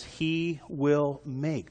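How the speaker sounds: slow, weighty "will", held on its own; stress on will. Shown as a demonstration. Preacher repeating the verse's promise, slow stress on "will". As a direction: spell out "he will make" word by word, leaning on "will". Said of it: "I want to emphasize the word will".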